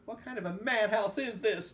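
high, loud outraged demand; angry scream. A narrator's reading. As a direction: shout it like an angry mob. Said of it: "the angry mob screamed".

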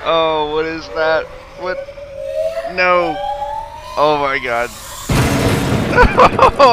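loud frightened shouting; panicked yelling. Real, not acted. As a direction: yell in real panic.

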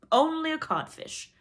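high, loud, slow jeer; mocking. A narrator's reading. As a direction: drive the insult home. Hidden voice insults Hook.

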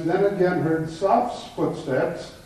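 normal talking voice

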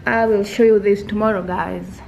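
ordinary delivery

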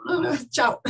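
mock dismissal. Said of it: "me dijeron".

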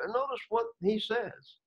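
usual speaking voice

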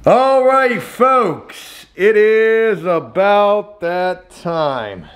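high, slow drawn-out call to the folks; hyped announcement. Real, not acted. Vlogger calls the folks to flooring time, drawn-out announcement. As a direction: stretch the words out like a showman's intro. Announcing the next stage to viewers.

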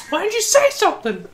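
very high put-on protest; mock indignation. Player protesting to game character, high mock indignation. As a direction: pitch it way up, hammed-up complaint.

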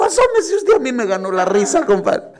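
high, loud voice breaking into laughter; laughing compadre. Acted. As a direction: squeal it out through giggles.

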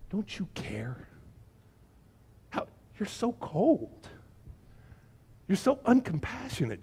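reproachful, hushed and drawn-out complaint; mock accusing. Martha confronts Jesus in the retold story, mock reproach. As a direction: play up the wounded accusation.

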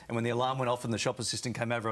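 normal talking voice

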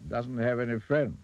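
goblin king. A narrator's reading. Goblin king meets rude Mister Uppity, kingly voice.

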